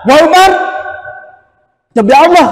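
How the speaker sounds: suara naik, sumpah keras; bersumpah. Retold or imitated. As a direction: teriak sumpah dengan nada tinggi. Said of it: "katanya, sampai bersumpah kan". (sumpah lantang dan tinggi)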